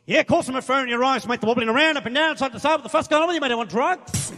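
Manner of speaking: loud, fast, blunt Aussie pub voice; brash Australian bloke. Retold or imitated. Comedian voicing the pub stranger who quizzes him, loud and blunt. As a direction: bark it like a tactless local. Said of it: "He just goes".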